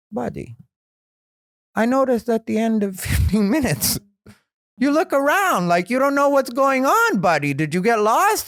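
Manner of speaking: put-on high, accented, teasing voice; Jamie's accent. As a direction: needle the young comic like a patient uncle. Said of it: "'cause Jamie has that accent".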